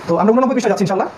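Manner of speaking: normal talking voice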